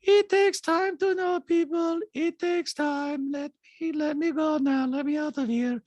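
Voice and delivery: high, detached, eerie monotone; Diana. Retold or imitated. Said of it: "So Diana said".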